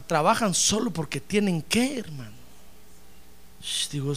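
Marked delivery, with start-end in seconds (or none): none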